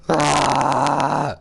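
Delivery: loud cry of frustration; a sharp yell of dismay; frustrated yell. Real, not acted. After the episode ends.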